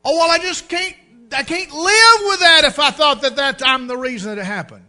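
high, loud guilt-stricken plea; anguished protest. Retold or imitated. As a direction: choke on the guilt.

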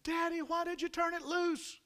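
very high, sustained wail; high-pitched, distressed child's protest; imitated child's screaming. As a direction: cry out like a panicked little girl. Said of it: "she starts screaming again".

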